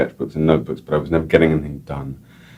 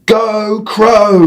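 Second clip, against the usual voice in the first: high, loud cheer for the crow; yelled cheer; 5 dB louder than usual. Retold or imitated. Family cheering the crow, high yell. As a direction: whoop it like a crowd. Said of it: "we yelled".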